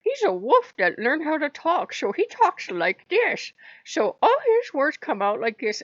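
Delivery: high, loud put-on wolf voice that learned to talk; hammed-up talking wolf, pitched high. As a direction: play a wolf who just learned to speak, high and eager. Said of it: "he likes to talk like".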